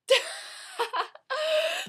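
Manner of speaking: shrill loud laugh rising; sudden high laugh at her own joke; high burst of laughter. Real, not acted. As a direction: let out a high squealing laugh.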